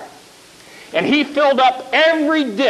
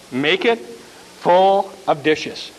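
calm prophet; 5 semitones lower, slower than usual. Acted. Prophet repeats the order.

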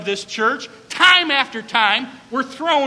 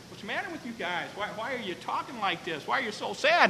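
much softer, caring question; gentle concern; 11 dB quieter, faster than usual. Acted. Jesus asking the two sad travelers, softly. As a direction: ask softly, as to grieving friends.

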